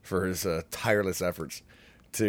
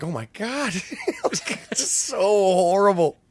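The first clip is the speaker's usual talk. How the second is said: laughing groan; 11 semitones higher, 4 dB louder than usual. Retold or imitated. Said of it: "you're like".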